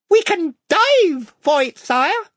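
high, slow, eager little mouse; a gallant small creature, very high; brave mouse voice. Acted. Reepicheep the mouse speaks.

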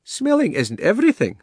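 very high-pitched lady elephant. A narrator's reading. She-elephant answering the bulldog, in a high character voice. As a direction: counter him in a high elephant voice. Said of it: "said the elephant".

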